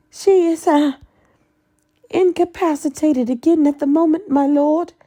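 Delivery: very high, hurried evasion; Bellatrix voice. Acted. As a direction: rush it high and nervous.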